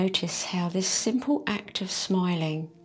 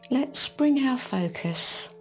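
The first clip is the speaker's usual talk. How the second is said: ordinary talk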